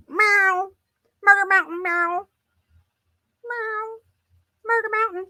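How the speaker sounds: high, squeaky meowing cat voice; playful cat voice; mock cat meow. Voicing the cat's meow.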